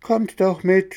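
put-on a cartful of boys yelling together; shouting children. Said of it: "schrien alle aus dem Wagen".

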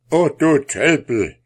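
furious shout at the fool; angry king. A narrator's reading. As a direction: bellow it like an outraged king. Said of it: "rief der König erbost".

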